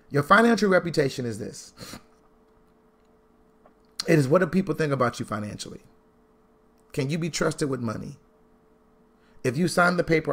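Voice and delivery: ordinary delivery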